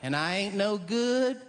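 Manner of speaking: high, slow Southern whine; sad Southern lady. Retold or imitated. Lady complaining about her looks, Southern whine. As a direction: moan it like a down-and-out Southern gal. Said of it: "some lady might go".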